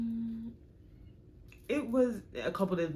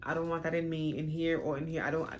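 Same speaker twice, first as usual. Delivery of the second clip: ordinary delivery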